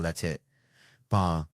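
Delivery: usual speaking voice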